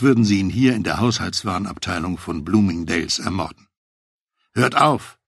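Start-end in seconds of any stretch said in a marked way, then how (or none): 4.5-5.2 higher, restrained narrated shout; shouted command. A narrator's reading. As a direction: call out sharply to stop them. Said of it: "schrie ich Robert und Greg an".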